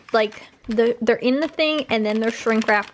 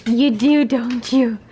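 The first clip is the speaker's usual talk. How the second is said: talking to a dog; 4 dB louder, slower than usual. Real, not acted. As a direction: fuss over the excited dog. Cooing to excited dogs.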